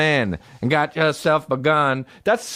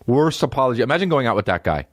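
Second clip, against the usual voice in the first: ordinary talk, nothing marked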